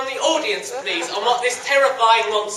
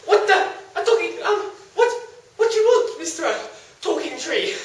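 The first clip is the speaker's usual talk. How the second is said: startled squeak; 8 semitones higher than usual. Acted. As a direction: yelp it in shock. Hero startled by tree.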